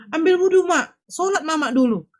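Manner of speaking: retold plea in a high, insistent voice; urgent pleading. Daughter telling distraught mother to pray first, pleading. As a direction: urge her, pitched well up.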